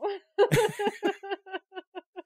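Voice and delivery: high, long laugh; real laughter at being asked to pick a favorite. Real, not acted. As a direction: crack up at the question. Laughs at the favorite-kid question.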